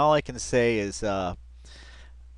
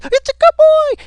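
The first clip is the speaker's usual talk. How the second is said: very high, loud squealed praise; screeching high pitch; 14 dB louder than usual. Retold or imitated. Mimicking the officer's screeching high-pitched dog praise. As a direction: squeal it like a man gushing over his dog. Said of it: "just this screeching high pitch".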